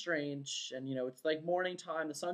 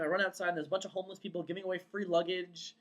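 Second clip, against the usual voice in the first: usual speaking voice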